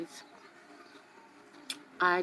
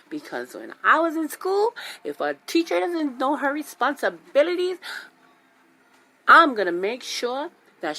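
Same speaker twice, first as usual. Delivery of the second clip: raised, higher voice venting at the teacher; heated rant; 8 semitones higher, 5 dB louder than usual. Retold or imitated. Mom rants to son about the teacher, heated and loud. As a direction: build up the outrage loudly.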